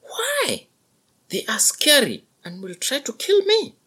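high, scared calf voice; frightened young buffalo. A narrator's reading. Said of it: "young buffalo asks".

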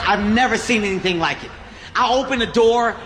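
normal talking voice

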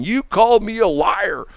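high-pitched mock scream of accusation; retold yelling. Irate customer to store staff, mock yelling. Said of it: "this guy is yelling and screaming".

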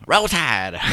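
high, loud Southern cheer; Southern accent. Retold or imitated. Coach's Southern cheer, hammed up for the host. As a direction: shout Roll Tide like a Southern fan. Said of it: "in a Southern accent".

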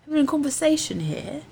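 a lightly voiced, self-admittedly bad Giles; Anthony Stewart Head. Podcaster trying the frogman as Giles, bad British impression. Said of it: "That was really bad".

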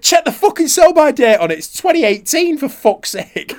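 high, loud ranting boss; furious boss. Retold or imitated. The boss, high and loud, raging about the sell-by date. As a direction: ham up the boss's fury.

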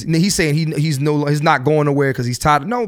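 normal talking voice

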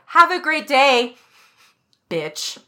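slow, loud, sing-song fake-sweet send-off; sarcastic farewell. Server sarcastically sending off the guest, fake-sweet. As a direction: sweeten it, then drop the insult. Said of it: "I was like".

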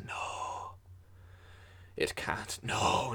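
unvoiced, choked-off disbelief; the digger whispers in dread; breathless whisper. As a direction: barely get the words out, breath caught. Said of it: "his breath catches in his throat, and he goes".

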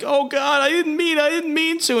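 high-pitched, hammed-up horror; mock panic. Acted panic after a stabbing.

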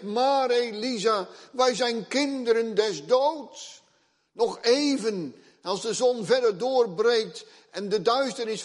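high, loud, anxious pleading; frightened boy. Acted. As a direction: beg the prophet in panic. Terrified boy pleads with Elisa.

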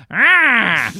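sharp high mock shout; a short spell-casting yell as she points; witch zap cry. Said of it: "points her finger, and goes".

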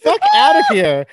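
high, loud joking outrage; mock outrage. Real, not acted. Host shrieks at her cohost in joking disgust, high and loud.

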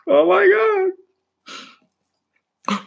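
high, loud, hammed-up wail; an over-the-top falsetto cry of despair; mock wail. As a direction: fake a breakdown over the news. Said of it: "I'm about to start crying".

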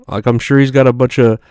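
ordinary talk

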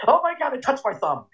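high, panicked squeal; hammed-up bat panic; panicked Farley quote. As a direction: shriek it like the bat just bit you. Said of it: "He's like".

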